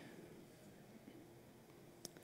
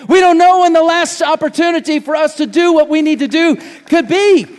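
higher, louder, urgent preaching climb; preaching at full force; 8 semitones higher, 6 dB louder than usual. Acted. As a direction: drive the warning home to the congregation.

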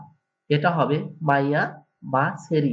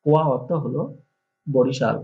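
ordinary talk, nothing marked

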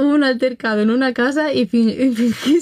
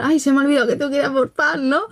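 fast, mock flustered excuse; quick nervous fib; hurried excuse; 3 semitones higher, faster than usual. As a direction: rush the fake excuse. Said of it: "retelling excuse to leave".

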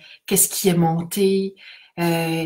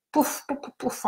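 light higher pouf pouf, soft; playful popping; 6 semitones higher, 6 dB quieter than usual. Acted. Guide pictures memories popping like bubbles.

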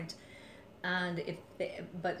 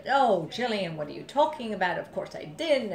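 joking denial; 4 semitones higher, 10 dB louder than usual. Retold or imitated.

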